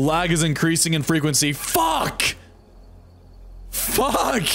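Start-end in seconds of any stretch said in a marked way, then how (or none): 1.5-4.5 high, shouted swearing; real frustrated outburst; frustrated curse. Real, not acted. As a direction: let the curse burst out. Stream lag, cursing out loud.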